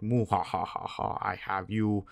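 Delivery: playful muahaha villain voice; mock villain laugh. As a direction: gloat softly like a pretend villain. Acts villain gloating over girlfriend.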